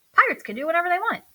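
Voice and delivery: fast, eager and carefree; rushed. A narrator's reading. Earl brushing off the couch rule, fast and eager. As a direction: tumble the words out.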